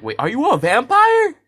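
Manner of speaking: high, loud, slowed shocked cry; shocked shout. Retold or imitated. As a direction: blurt it out in alarm. Shocked question to the vampire.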